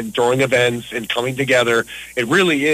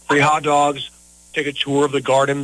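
ordinary delivery

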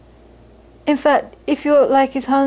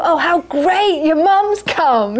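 high, drawn-out imitation of gushing; delighted welcome; 5 semitones higher, slower than usual. Audience members delighted that the speaker's mother came, gushing imitation. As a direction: coo slowly over a guest, pitched up. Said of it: "'cause they thought".

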